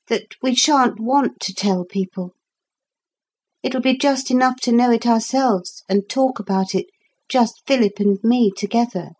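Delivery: put-on high-pitched girl's voice; girl character. A narrator's reading. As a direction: confide as a child, voice lifted.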